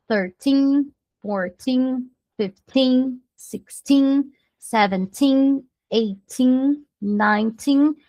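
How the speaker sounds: put-on "thirteen" to "nineteen" with held final "teen"; prolonged TEEN endings. Shown as a demonstration. Teacher repeats the teens for students, endings prolonged. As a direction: hold each "teen" on a sustained note. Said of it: "você precisa prolongar".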